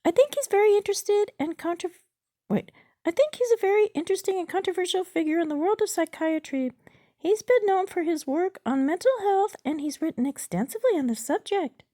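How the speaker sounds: very high, loud bot character; chatbot voice. Acted. Chatbot gives user its opinion of the doctor, high bot voice. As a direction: pitch it way up as the chatbot.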